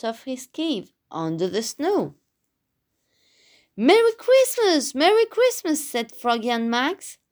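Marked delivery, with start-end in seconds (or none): 3.8-5.8 high-pitched, cheery Christmas cheer; excited greeting. A narrator's reading. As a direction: call out a delighted holiday greeting. Friends greet the woken bear.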